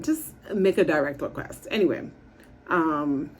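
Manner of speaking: normal talking voice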